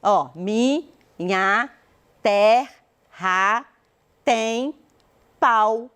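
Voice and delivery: very slow, a gap after each syllable. Shown as a demonstration. Teacher showing a class grammatical syllable division, syllable by syllable. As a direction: split every word into separate, drawn-out syllables. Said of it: "dividir em sílaba cada palavra".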